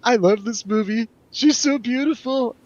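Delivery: normal talking voice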